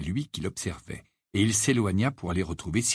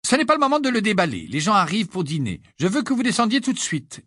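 high, quick, insistent mother voice; brisk motherly order; 9 semitones higher, faster than usual. A narrator's reading. Mother telling children to come down for dinner, brisk and insistent. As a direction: order them down, high-pitched and hurried.

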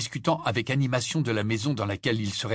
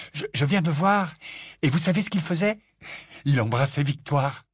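breathless excited; 6 semitones higher than usual. A narrator's reading. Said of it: "tout essoufflé".

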